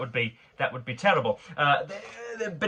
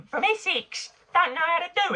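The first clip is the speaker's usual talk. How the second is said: much higher, squeaky put-on Essex accent; mock Essex voice. Jibing at Essex people over fake accents, in a squeaky mock Essex voice.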